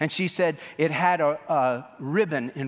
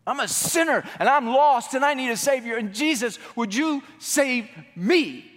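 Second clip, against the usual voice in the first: much higher and louder, slowing at the end; desperate plea; 10 semitones higher, 4 dB louder than usual. Retold or imitated. Young sinner begging Jesus to save him, loudly. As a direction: cry out for rescue. Preacher reenacts his own conversion plea.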